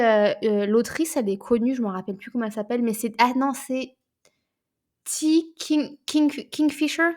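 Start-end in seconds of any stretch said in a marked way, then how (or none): none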